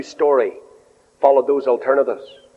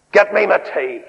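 curt, higher-pitched mock order; mock demanding; 4 semitones higher than usual. Son home from work ordering mum about, mock demanding.

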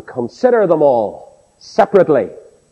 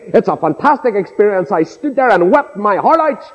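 raised-pitch pulpit testimony; impassioned preaching; 8 semitones higher than usual. Acted. Preacher testifies to the miracle of birth, impassioned preaching. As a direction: tell it with a preacher's fervour.

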